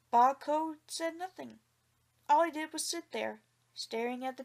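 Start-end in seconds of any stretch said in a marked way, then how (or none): none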